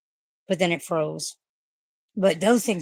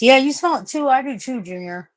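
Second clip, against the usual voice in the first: high, lightly sing-song voice to the dog; pet talk; 8 semitones higher, 5 dB louder than usual. Real, not acted. Owner to her dog noticing the smell, high pet talk. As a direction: coo at the dog sniffing along.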